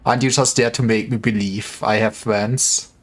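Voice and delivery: ordinary talk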